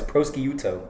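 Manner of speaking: slow, quiet "prosciutto" with sk and YOO; insistent mispronunciation. Shown as a demonstration. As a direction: correct them with a hard-sk "prosciutto", pross-kee-yoo-toe. Insists on the wrong pronunciation.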